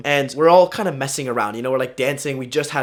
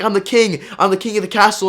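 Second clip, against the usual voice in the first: gloating; 6 semitones higher, 4 dB louder than usual. Retold or imitated. Child gloating on the table.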